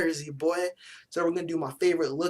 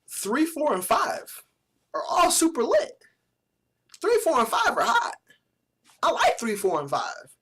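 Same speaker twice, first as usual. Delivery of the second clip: excited high squeal, louder, breaking; voice cracking; 9 semitones higher, 5 dB louder than usual. Real, not acted. Fan raving over his three favorite jerseys, voice cracking. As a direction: hype it up until the voice cracks. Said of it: "is making me voice crack".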